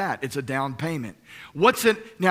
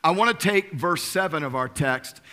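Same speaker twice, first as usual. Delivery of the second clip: normal talking voice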